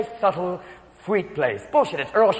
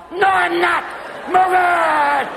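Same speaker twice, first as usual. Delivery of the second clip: angry yell; 6 semitones higher, much slower than usual. Retold or imitated. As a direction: bark it at the husband. Wife yelling in the car.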